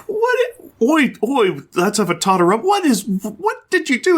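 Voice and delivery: mock British hero; high mock Spider-Man. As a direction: play a flustered young hero. Spider-Man quoted from the comic.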